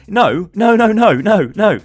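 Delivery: loud, high run of nos; emphatic no. Real, not acted. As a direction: shut it down hard. Rejecting toxic behaviour.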